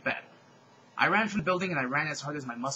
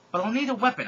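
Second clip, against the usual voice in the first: firm, louder and higher declaration; mildly acted resolve, pitched up; affirmative; 7 semitones higher, 6 dB louder than usual. A narrator's reading. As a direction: say it with decisive emphasis. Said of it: "I said affirmatively".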